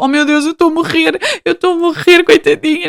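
high, hammed-up wail of pity; mock dramatic pity. Telling friends, hammed-up high wail of pity. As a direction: overplay the heartbreak, high and whiny.

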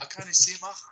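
high-pitched mock Scouse; Scouse accent. Shown as a demonstration. Guest tries the practice phrase, high Scouse accent. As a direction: pitch it up and scrape "mach" like a Scouser. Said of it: "if you wanna do a good Scouser accent".

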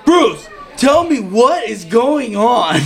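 loud, high, slow mock outburst; retold dad's demand. Dad pressing the deputy for answers, loud drawn-out retelling. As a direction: stretch out an exasperated demand.

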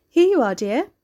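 put-on a bright welcome from the old hostess; lightly voiced hostess greeting. A narrator's reading. Landlady voiced in audiobook.

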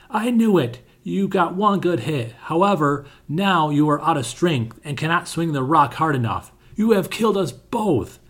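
high, taunting sneer; mock him from above. A narrator's reading. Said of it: "said mockingly".

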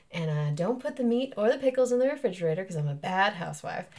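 Mississippi accent on a stage line. Shown as a demonstration. Actor demonstrating her play line, Mississippi accent. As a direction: say it like a Mississippi housewife. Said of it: "now I can get into it".

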